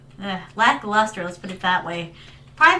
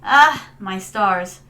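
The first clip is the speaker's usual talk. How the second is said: ordinary talk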